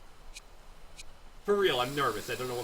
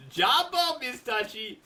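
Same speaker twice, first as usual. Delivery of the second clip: laughing; 7 semitones higher, 4 dB louder, slower than usual. Real, not acted. Laughing after the chase.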